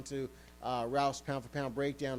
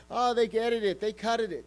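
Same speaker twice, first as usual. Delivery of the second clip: high, louder whiny mock complaint; mocking Paulie; 5 semitones higher, 5 dB louder than usual. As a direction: sneer it like a sore loser. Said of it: "Paulie's out there telling everybody".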